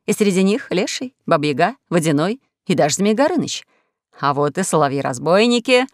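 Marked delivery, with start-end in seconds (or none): none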